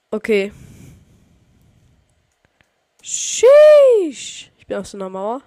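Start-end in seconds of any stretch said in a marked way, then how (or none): 3.0-4.5 high, loud drawn-out sheesh; stretched, hyped exclamation; excited yell. Real, not acted. Surprise on top of wall.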